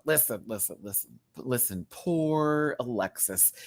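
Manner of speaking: ordinary talk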